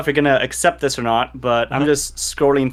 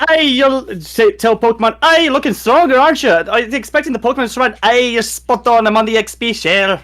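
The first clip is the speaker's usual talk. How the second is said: put-on Scottish accent; 9 semitones higher, 3 dB louder than usual. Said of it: "Sword and Shield dot Scottish".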